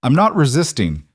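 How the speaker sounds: firm and carrying; clear and firm. A narrator's reading. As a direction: project it through the cabin. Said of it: "Evan said clearly".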